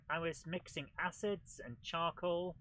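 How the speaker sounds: ordinary delivery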